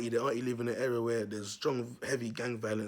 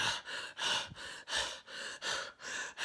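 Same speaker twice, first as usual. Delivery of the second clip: gasping, breathless panting; imitated panting; 7 dB quieter than usual. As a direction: pant like a runner who has collapsed. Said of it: "he's out of breath".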